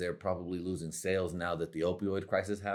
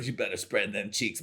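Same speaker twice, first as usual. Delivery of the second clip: ordinary talk, nothing marked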